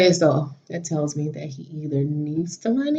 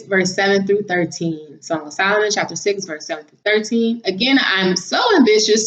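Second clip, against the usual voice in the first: ordinary talk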